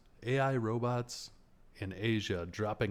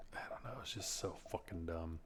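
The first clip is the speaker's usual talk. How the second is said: whispered, much softer, fed up; exasperated whisper; 3 semitones lower, 10 dB quieter than usual. Real, not acted. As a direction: drop to a disgusted whisper. Exasperated aside about the movie.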